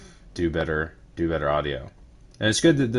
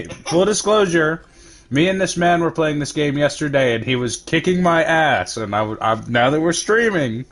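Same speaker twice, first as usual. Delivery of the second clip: excited; 7 semitones higher, 6 dB louder than usual. Real, not acted. Excited hype to stream.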